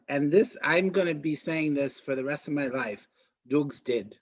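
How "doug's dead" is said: lightly attempted Australian accent; shaky attempt at an Antipodean, Australian accent. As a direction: imitate Korg's accent, roughly. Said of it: "do it with an Australian accent".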